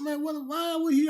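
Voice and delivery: squeaky high disbelieving outburst; high, startled question; shocked disbelief. Retold or imitated. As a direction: jump way up in pitch, stunned. Partner shocked by the news.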